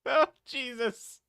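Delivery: ordinary delivery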